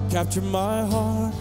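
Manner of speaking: ordinary talk, nothing marked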